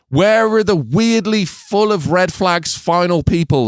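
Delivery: high, drawn-out mock lament; exasperated rant. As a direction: demand it in rising comic despair. Comic rant listing what is missing.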